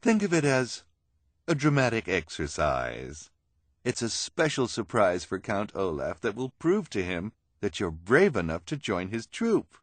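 a hint of a theatrical villainess; lightly voiced schemer. Esme persuades the freaks.

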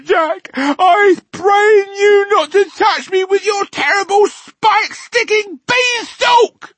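pleading that climbs to very high, loud shrieks; terrified screaming. A narrator's reading. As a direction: beg for mercy, frantic and screeching. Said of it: "screamed the flesh lump eater".

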